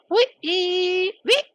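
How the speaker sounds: a shrill-sounding high-pitched squeal of surprise. Real, not acted. Gamer squealing at a sudden crash, high squeal.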